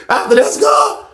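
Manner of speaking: high, strained comic promise; hammed-up promise. Husband bargaining with his wife, high and hammed-up. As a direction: squeeze it out high after a laugh. Repeats promise after laughing, exaggerated.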